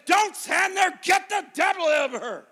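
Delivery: shouted order. Retold or imitated. Preacher reenacting orders to the ladies around her, shouted.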